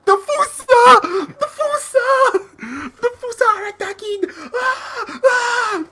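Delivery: very high shrieked cartoon alarm; mock panic. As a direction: scream in hammed-up terror. Acting panicked lemurs from the film.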